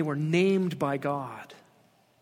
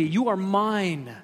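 "You are mine" said slowly, every word weighted; slow deliberate "You are mine"; slow emphatic stress; slower than usual. Shown as a demonstration. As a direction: lean hard on "mine" to drive the claim home. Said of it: "This is a very emphatic way in the Hebrew".

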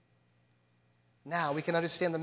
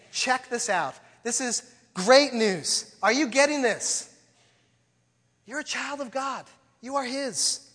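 excited; 5 semitones higher, 5 dB louder, slower than usual. Acted. Paraphrasing the apostle, excited.

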